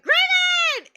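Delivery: a high loud shouted name. Retold or imitated. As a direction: call out across the table. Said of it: "And I'm like".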